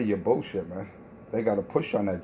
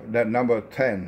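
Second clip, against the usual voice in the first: normal talking voice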